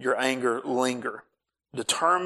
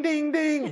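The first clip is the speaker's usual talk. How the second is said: chime imitation. Imitating fuel warning chime.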